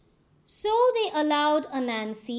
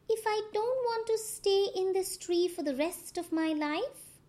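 higher, lightly voiced spider; Anansi's voice; 7 semitones higher than usual. A narrator's reading. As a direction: talk yourself into a plan. Anansi talks to himself.

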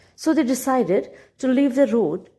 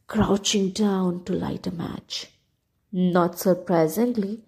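usual speaking voice

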